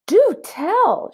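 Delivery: high, bright amazed exclaim; high amazed Do tell; delighted surprise. Acted. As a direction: marvel at the recipe. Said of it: "she said".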